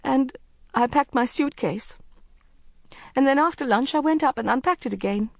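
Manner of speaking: lightly tearful confession; trembling voice. A narrator's reading. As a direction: speak as if about to cry. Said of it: "her voice trembling".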